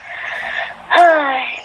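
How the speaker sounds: long tired sigh; weary sigh. Acted. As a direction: let out a heavy sleepy sigh. Exhausted before dawn routine.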